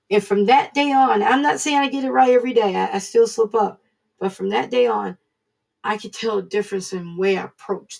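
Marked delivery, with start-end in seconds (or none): none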